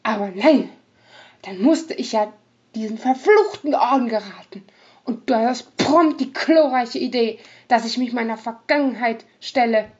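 loud, bitter outburst; angry rogue. Acted. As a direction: lash out at her. Ota lashes out at Chico.

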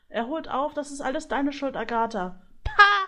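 high goose voice, ending in loud scorn; squabbling goose. A narrator's reading. Goose blames Agatha as griffin catches up, squabbling. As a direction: blame her shrilly, then scoff loudly. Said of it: "Das zänkische Geschnatter".

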